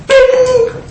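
sharp, very high imitated ping; notification ping. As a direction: chirp it like a message alert. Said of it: "that noise with the Facebook Messenger".

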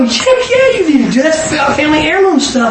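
enthusiastic urging. Retold or imitated. Urging the dentist to take the photo, high with laughter. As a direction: push him on with bubbling joy.

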